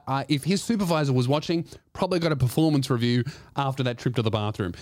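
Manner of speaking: ordinary talk, nothing marked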